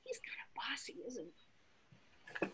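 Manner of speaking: whispered aside. Acted. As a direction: share a secret with the kids.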